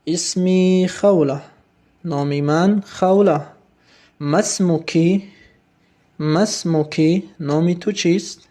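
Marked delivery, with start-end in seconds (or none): 4.2-7.3 مسموکی spelled out slowly, ending in a rising ee; slow Arabic model. Shown as a demonstration. Teacher gives students the phrase to echo, slow and rising. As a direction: draw out مسموکی with a lifting end. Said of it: "و اعد، تکرار کن".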